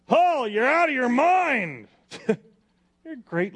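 high, loud, slow shouted accusation. Acted. Festus shouts at Paul in court, loud and slow. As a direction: bellow it at Paul from the bench. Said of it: "Festus said in a loud voice".

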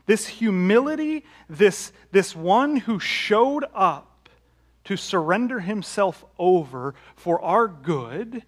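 high, loud and slow, building fervour; preaching climax. Real, not acted. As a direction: lift the congregation with it. Sermon climax on Christ's humility.

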